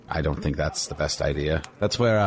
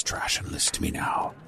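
hushed whisper; 7 dB quieter than usual. Acted. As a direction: lean in and whisper it close. Leaning in to confide.

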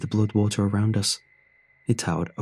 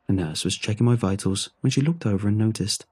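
ordinary delivery, nothing marked

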